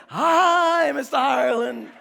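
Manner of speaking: loud, drawn-out mock chorus of children; pre-K kids. Pre-K kids all greet a classmate's dad, loud mock chant. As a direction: imitate a class calling out a greeting. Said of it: "Then the kids, all of them would say".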